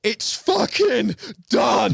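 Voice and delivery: slow, high, drawn-out triumphant shout; elated shout. Retold or imitated. Angler shouting to camera after landing the fish, elated. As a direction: bellow the win out. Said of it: "the one where I'm like".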